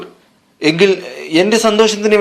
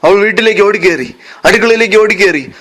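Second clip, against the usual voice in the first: ordinary delivery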